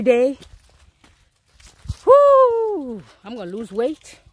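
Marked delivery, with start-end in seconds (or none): 2.1-3.0 high, loud "woo" of exertion; loud winded whoop. Real, not acted. Walker exerting herself on the hike, loud high woo. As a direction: let out a big high puffed woo. Puffing on an uphill walk.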